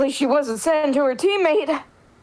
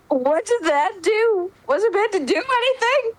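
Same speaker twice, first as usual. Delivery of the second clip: baffled Maida; 7 semitones higher than usual. Acted.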